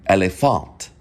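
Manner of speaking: "Elefant" with open E and final -ahnt; German pronunciation of "Elefant". Shown as a demonstration. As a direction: demonstrate "Elefant" as el-eh-FAHNT. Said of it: "of course the E, like".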